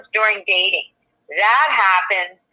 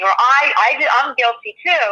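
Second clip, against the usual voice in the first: ordinary talk, nothing marked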